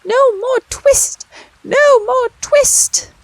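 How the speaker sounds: very high, slow squeaky chant; little twittering voices shouting. A narrator's reading. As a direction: shout it like a crowd of tiny mice. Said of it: "shout at once in little twittering voices".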